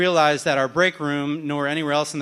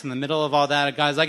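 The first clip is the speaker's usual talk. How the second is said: ordinary talk, nothing marked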